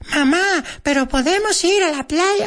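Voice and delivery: squeaky, very high mock child; fake silly-kid voice. Child asking mamá about the beach, mock helium squeak. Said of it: "does it sound like this".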